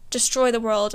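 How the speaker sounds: ordinary delivery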